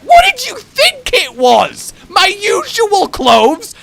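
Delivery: high, loud, drawn-out incredulous shout; hammed-up offended retort, stretched out; mock indignation. Customer retorting about his owl onesie, mock indignant shout.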